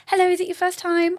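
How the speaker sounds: high, loud friendly greeting; bubbly welcome. Retold or imitated. Her welcoming a Zumba newcomer, bubbly high voice.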